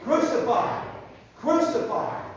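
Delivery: rally the crowd to yell along; yelling mob. Acted. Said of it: "They were yelling".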